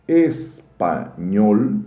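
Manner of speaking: "espanhol" drawn out very slowly, syllable by syllable, loud; syllable-by-syllable demo. Shown as a demonstration. Teacher showing students the tonic syllable, "espanhol" stretched out syllable by syllable. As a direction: say "espanhol" in three long, separate syllables. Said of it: "Se eu digo".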